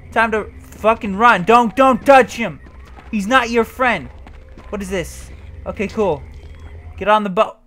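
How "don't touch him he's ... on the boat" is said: ordinary talk, nothing marked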